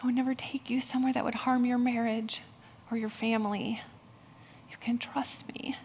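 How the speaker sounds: gentle God voice. Retold or imitated. God reassuring the frightened pastor, softly whispered. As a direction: hush it like a loving father calming a child. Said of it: "And he would say".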